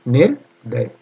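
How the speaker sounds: निर्दय split as nir... day; low, with a break mid-word; syllable-by-syllable dictation. Shown as a demonstration. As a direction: dictate निर्दय in two pieces. Teacher dictating spelling slowly.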